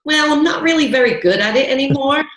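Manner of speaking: put-on quick try at a New York accent. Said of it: "That's a good, that's a good attempt".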